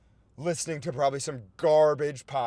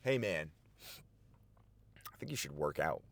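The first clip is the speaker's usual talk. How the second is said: lower, softer inner voice; calm inner voice; 10 semitones lower, 9 dB quieter than usual. Retold or imitated. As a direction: talk yourself down gently. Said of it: "Jakey thinks to himself".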